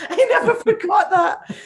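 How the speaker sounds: laughing high and loud; real laughter breaking through the words. Real, not acted. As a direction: burst out laughing as you say it. Laughing at the memory.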